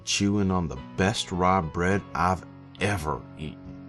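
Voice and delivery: usual speaking voice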